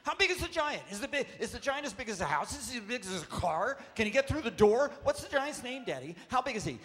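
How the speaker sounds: put-on high, loud eager little kid pestering Daddy; excited child voice. Dad imitates his small daughter asking about the giant, high and eager. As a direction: squeal it like a wide-eyed four-year-old.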